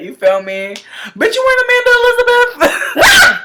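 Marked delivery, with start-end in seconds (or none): 1.2-3.4 very high squeaky falsetto; playful, hammed-up high-pitched heckle; mock outrage. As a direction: tease in a squealed fake challenge. Mock challenge, then says I'm playing.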